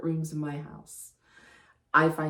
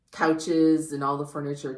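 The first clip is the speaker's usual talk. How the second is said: normal talking voice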